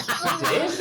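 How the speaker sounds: high giggly surprised question; laughing. Retold or imitated. Security asking back, high and laughing.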